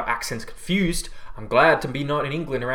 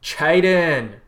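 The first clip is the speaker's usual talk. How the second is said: excited greeting; 4 semitones higher, 7 dB louder than usual. Real, not acted. Greeting a viewer by name.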